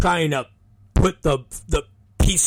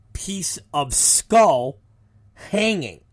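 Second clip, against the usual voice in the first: ordinary delivery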